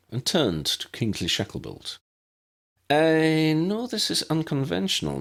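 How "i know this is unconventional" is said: high, prim Scottish headmistress voice; brisk, proper elderly professor; McGonagall. A narrator's reading.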